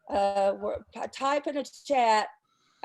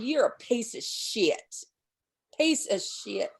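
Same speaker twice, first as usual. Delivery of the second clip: ordinary talk, nothing marked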